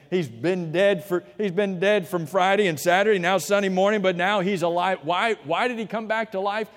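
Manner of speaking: fervent pulpit preaching; preaching at full force. Acted. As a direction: build to the triumph of the resurrection.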